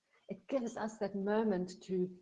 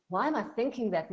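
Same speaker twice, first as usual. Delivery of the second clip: louder and fast, self-scolding; judgmental; 3 semitones higher, 5 dB louder, faster than usual. Shown as a demonstration. Coach shows harsh self-judgment, fast and louder. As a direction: snap at yourself for the thought. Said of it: "not with judgment".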